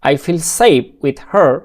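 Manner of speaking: very slow, every word spaced out; teacher modelling a sentence; slow model reading. Shown as a demonstration. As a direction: say it slowly so they can copy. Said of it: "উচ্চারণ করে খেয়াল করুন".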